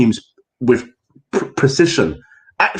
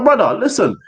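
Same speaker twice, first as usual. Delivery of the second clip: ordinary talk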